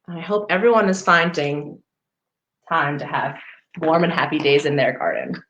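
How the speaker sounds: plain reading voice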